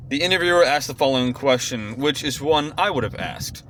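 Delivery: ordinary talk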